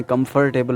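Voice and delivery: "कंफर्टेबल" as kum-fur-TAY-bul; "कंफर्टेबल" in four full syllables; Urdu-Hindi pronunciation. Shown as a demonstration. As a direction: say "कंफर्टेबल" with the R sounded. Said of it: "Urdu या हिंदी में बोल रहे हैं".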